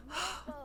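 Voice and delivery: soft, high sigh of relief; acted sigh, nerves easing; relieved sigh. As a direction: let out a light, relieved breath. Voicing character's relieved reply.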